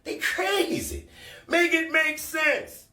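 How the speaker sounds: high, slow disbelief; incredulous. Real, not acted. Mocking critics worried about the internet, high slow disbelief. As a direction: stretch it out, baffled by them.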